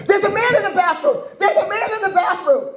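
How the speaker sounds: high imitation of a panicked woman; mock alarmed cry. Startled woman at the mirror raising alarm, high mock panic. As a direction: ham up her alarm, pitched high. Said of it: "She goes".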